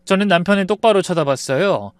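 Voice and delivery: ordinary delivery, nothing marked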